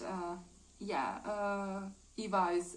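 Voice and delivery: ordinary delivery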